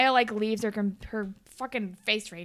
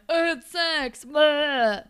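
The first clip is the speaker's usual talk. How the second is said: higher, louder mock drunk blurt; drunk Drew; 6 semitones higher, 7 dB louder, much slower than usual. Drunk guy falling down the stairs on tape, mock-drunk. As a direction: imitate a drunk yelling while tumbling downstairs. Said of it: "drunk Drew is on the tape".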